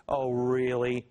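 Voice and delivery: drawn-out sarcastic oh really. Real, not acted. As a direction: feign being impressed, dripping doubt. Sarcastic scoff at officials.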